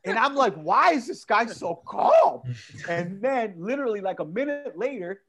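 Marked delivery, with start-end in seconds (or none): 0.6-2.4 loud, high, slow disbelief; drawn-out exasperated question; mock exasperation. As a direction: wail it in comic bafflement. Baffled inner reaction to calm passenger.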